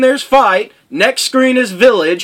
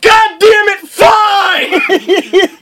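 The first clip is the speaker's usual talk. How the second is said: much higher, louder giving-up yell; worn-down player finally caving, shouting; exasperated shout; 10 semitones higher, 3 dB louder, much slower than usual. Retold or imitated. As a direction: throw the words out in defeat. Giving in after endless refusals.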